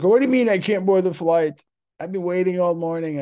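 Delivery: high, loud outraged imitation; mock the passenger's outburst at the gate; making noise. Drunk passenger shouting at the gate agent, high outrage. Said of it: "she was making all this noise".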